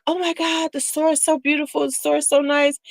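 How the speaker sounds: high, airy, swooning mock rave; mock gushing shopper. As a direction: parody the shop fans raving. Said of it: "but they're like".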